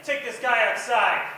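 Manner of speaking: hammed-up mobster laying down the law, laughing; angry Italian mobster. Comic mob boss orders his men, loud and laughing. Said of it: "like, an angry Italian mobster".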